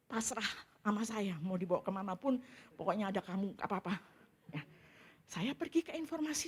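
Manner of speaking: normal talking voice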